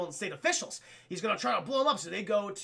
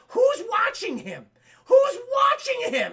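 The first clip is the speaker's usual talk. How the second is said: high, slow, drawn-out shouting; incredulous shout; 13 semitones higher, 8 dB louder, slower than usual. Real, not acted. Streamer protesting a plot hole to chat, incredulous shout. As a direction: wail it in exasperated disbelief.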